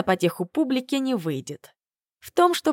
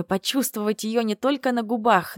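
usual speaking voice

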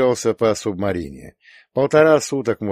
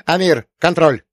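loud higher command; shouted order; 7 semitones higher, 5 dB louder than usual. A narrator's reading. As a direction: call the order out sharply. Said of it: "крикнул Рута". (громкий окрик)

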